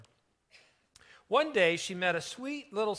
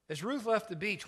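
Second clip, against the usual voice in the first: ordinary delivery, nothing marked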